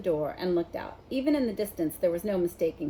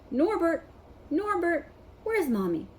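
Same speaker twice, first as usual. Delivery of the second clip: cooing; 9 semitones higher than usual. A narrator's reading. Hagrid cooing to dragon.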